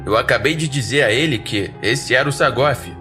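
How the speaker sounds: plain reading voice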